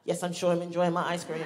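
ordinary talk, nothing marked

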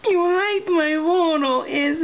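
high silly mocking voice. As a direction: pitch it up and tease. Mocking a photo comment.

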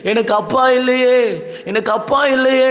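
high, crying-out lament, reenacted; retold wailing. Preacher reliving the night he mourned his father, wailing high. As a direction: cry out for a lost father as he once did. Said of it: "owned கத்தினேன்".

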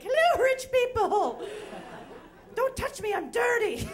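high, loud, laughing mock cry; laughing impression of yourself shouting at shoppers; mock greeting. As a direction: ham it up through laughter. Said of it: "and you're like".

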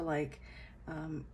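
ordinary talk, nothing marked

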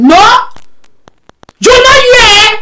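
high loud indignant cry; outraged no. Real, not acted. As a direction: explode in disbelief. Outraged reaction to gossip.

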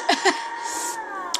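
high airy laugh after the smash; a quick laugh that slips out; breathy laugh. Real, not acted. Gamer laughing at the smash, airy laugh.

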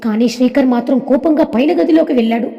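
usual speaking voice

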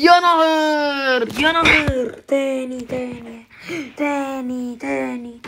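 shocked wail after dying; gasping shock. Real, not acted. Dies again in the game. (صرخة صدمة)

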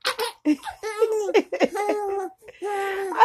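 high burst of laughter; laughing. Real, not acted. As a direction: crack up laughing. Grandma laughing at baby.

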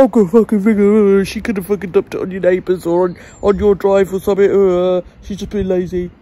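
loud, dismissive mock of a workman; scornful impression of the late workman. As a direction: sneer it out like the lazy workman. Mimicking workman blaming driver.

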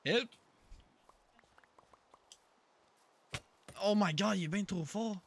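loud, high panicked yells; panicking gamer. Real, not acted. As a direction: scream for help mid-fight. Panicking in a game fight.

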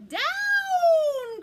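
loud, high, drawn-out shout; witch's cry. Acted. Witch ordering the broomstick to the ground, crying out. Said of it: "cried the witch".

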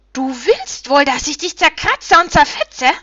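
loud, furious screech, rising high; raging harpy. A narrator's reading. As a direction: threaten in a wild rage as a harpy. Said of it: "raste sie vor Wut".

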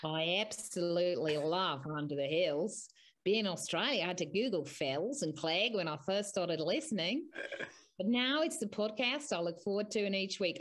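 put-on Australian accent, swooping in pitch. Host reads a listener's review aloud in an Australian accent. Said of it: "are you gonna do the, uh, Australian accent".

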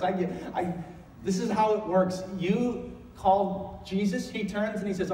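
ordinary talk, nothing marked

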